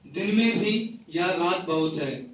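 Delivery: measured, repeating recital of a poem; poetry recital. Acted. Poet reciting a rain poem to the gathering, measured recital. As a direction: recite each line twice, savouring it.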